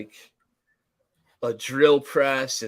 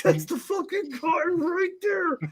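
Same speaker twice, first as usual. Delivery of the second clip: high excited exclaim. Retold or imitated. Excited callback to movie.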